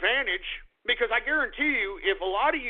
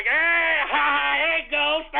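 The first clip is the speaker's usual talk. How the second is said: higher, louder goofy greeting; mock dumb listeners; 6 semitones higher, 5 dB louder, slower than usual. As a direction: ham up a silly crowd greeting. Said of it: "everybody's like".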